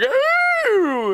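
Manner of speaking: very high scandalised ooh; shocked crowd ooh. Acted. Townsfolk reacting to the guard's insult, scandalised ooh.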